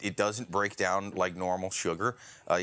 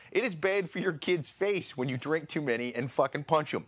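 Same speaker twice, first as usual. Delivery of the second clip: ordinary delivery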